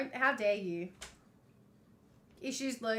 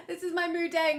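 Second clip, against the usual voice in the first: laughing; 6 semitones higher, 4 dB louder than usual. Real, not acted.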